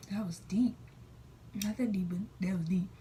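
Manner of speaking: hushed, breathy-free whisper to herself; whispered aside. Real, not acted. Vlogger whispering a joke to the camera. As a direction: drop to a whisper for a wry aside.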